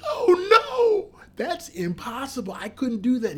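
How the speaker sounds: mock-panicked refusal, laughing it off; hammed-up disbelief, shout fading to a whisper. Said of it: "And I was like".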